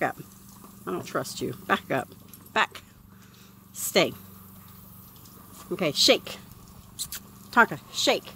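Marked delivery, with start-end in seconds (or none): none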